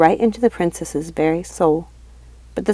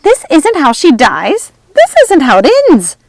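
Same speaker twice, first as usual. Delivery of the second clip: high, loud panicked witch shriek; terrified witch screaming; panic-stricken scream; 10 semitones higher, 10 dB louder than usual. A narrator's reading. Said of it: "Lucinda screamed, panic-stricken".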